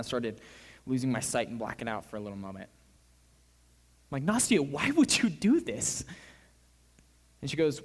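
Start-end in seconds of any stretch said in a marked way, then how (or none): 4.2-6.0 high, drawn-out incredulous question; acted-out exasperated disbelief; dismayed disbelief. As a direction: plead for an explanation, pitch raised. Teller acts out dismay at her fasting.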